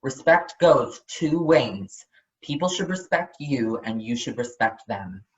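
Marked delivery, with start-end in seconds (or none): none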